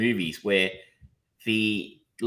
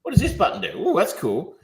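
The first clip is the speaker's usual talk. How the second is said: higher, playful mock curiosity and delight; wide-eyed button-pusher; 6 semitones higher than usual. Host mimicking an editor playing with software, mock wonder. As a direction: imitate someone gleefully trying every button. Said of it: "it's almost like someone's going".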